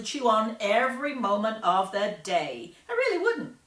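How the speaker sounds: high, loud, boastful chatter; loud bragging girl. Acted. Violet bragging about gum to the reporters, loud and high. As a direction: show off to everyone, loud and high. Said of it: "talking very fast and very loudly".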